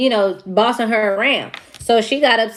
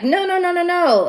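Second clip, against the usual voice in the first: panicked denial; 8 semitones higher than usual. Retold or imitated. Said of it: "And she was like".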